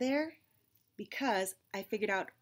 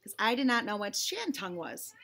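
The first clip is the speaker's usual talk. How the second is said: ordinary delivery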